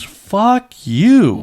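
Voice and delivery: a high, loud, furious fuck you; angry outburst. Retold or imitated. Telling off the friend who slept with his wife, furious. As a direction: hurl it at him like a final blow. Ends tirade at the betraying friend.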